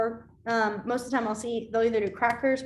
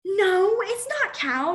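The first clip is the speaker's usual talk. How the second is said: put-on stubborn kid; 8 semitones higher, 4 dB louder, much slower than usual. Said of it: "she'd just be like".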